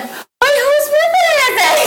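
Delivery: confused squeal. Retold or imitated. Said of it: "and she's like".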